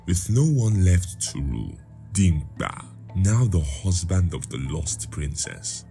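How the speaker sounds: ordinary delivery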